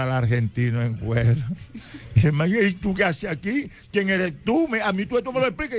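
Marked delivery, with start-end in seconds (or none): 2.5-5.8 higher, fast demanding voice; furious husband. Retold or imitated. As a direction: demand answers from the intruder. Husband confronts hidden lover. (marido furioso, voz alta y rápida)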